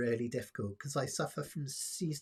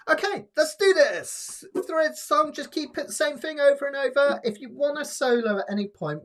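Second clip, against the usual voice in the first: higher and louder, rallying the group; pumped-up start; 12 semitones higher, 9 dB louder than usual. Real, not acted. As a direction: get everyone fired up to sing. Teacher revving up the singalong.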